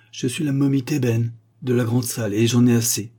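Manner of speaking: an ancient mummy who has had enough; irritated mummy. Acted. Mummy speaks to curator.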